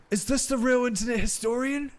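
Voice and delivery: high, put-on starstruck fan voice; squeaky excited fan question; exaggerated cartoon intonation. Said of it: "why are you talking like that?".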